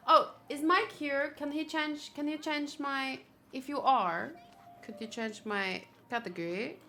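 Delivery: ordinary delivery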